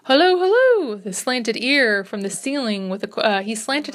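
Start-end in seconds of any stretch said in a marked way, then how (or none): 0.1-1.0 high, loud hail up the stairs; calling out. A narrator's reading. Doctor calling out at a sudden sound, loud high hail. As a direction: call out sharply to someone above.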